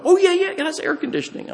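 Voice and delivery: very high, quick imitation of a seller; mock insistent assurance. Seller insisting to a doubtful buyer, mock insistent assurance. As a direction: rattle it off high, mimicking a too-eager salesman.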